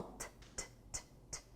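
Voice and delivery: T, t, t, t as voiceless taps with no vowel; teacher showing the T sound; isolated T sound. Shown as a demonstration. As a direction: tap out the bare T. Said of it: "Isso é som".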